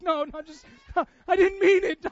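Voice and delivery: high, loud, laughing protest; embarrassed laughing denial; laughing backpedal. Retold or imitated. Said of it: "you're like".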